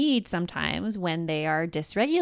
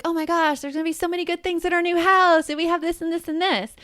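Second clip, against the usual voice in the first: overly bright, higher voice; pep-talk cheer, laid on thick; high forced enthusiasm to a sad child; mock upbeat cheerleading; 9 semitones higher than usual. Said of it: "what I would probably go to is be like".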